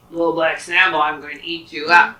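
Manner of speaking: growling tiger threat. Acted. Tiger threatening to eat the boy, loud and menacing. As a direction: loom over him like a hungry tiger.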